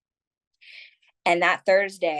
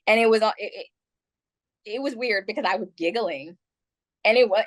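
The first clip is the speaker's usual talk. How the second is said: usual speaking voice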